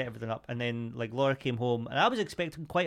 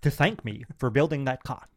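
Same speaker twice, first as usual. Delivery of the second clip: Andrew Tate impression. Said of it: "quite a good impression".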